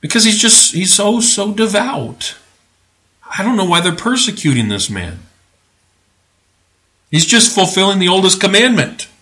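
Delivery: mock sympathy. Preacher pretending the father is persecuted, mock sympathetic. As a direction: plead his case with fake heartbreak.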